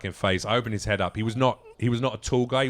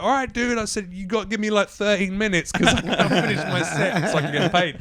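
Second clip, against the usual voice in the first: breezy reply; 8 semitones higher, 5 dB louder than usual. Retold or imitated. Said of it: "I'm like".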